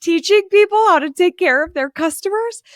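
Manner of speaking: high, loud, teary laugh through the words; choked-up laughing question. Retold or imitated. Host retelling tearing up during training, laughing teary. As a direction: laugh at herself while choking up. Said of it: "I'm getting totally choked up. And I'm like".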